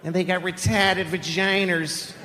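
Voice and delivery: slow, high Boston teacher voice; Boston accent teacher. Acted. Seventies teacher instructing the class, slow high Boston accent. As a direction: stretch the vowels like a Boston teacher.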